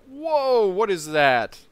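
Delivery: loud, high sudden whoa; surprised whoa. Real, not acted. Spotting a ship in the harbour, loud and amazed. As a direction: react like something amazing just appeared.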